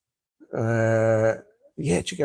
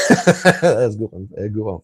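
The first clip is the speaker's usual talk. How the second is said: laughing; 5 dB louder than usual. Real, not acted. Laughing at a viewer's joke.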